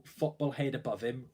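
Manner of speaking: usual speaking voice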